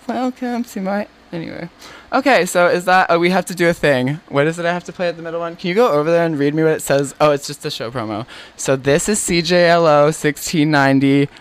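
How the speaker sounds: ordinary talk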